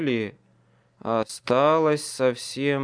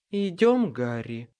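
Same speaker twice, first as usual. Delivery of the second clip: purring; 2 semitones higher than usual. Acted. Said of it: "мурлыкнула Леа голосом".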